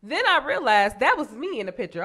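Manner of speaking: normal talking voice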